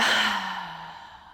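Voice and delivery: long voiced sigh of release; big sigh. Shown as a demonstration. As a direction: sigh the tension out audibly. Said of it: "Sigh it out".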